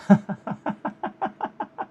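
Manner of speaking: airy, voiceless giggle; laughing at his own clumsiness; breathy laugh. Real, not acted. Laughs after slapping the microphone.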